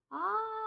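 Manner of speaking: short, high, loud cry of pain; retold scream of a woman shot. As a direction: yelp as the bullet hits. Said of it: "करके जोर से नीचे गिर गई".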